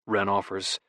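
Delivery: ordinary talk, nothing marked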